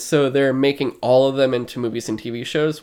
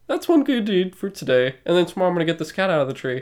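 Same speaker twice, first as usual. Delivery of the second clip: hero voice; 6 semitones higher, faster than usual. Retold or imitated. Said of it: "And then it's like".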